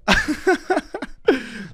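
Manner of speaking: high-pitched run of laughter; real giggling fit; cackling. Real, not acted. Streamer dying at his own prank, high cackle.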